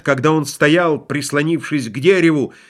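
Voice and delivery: usual speaking voice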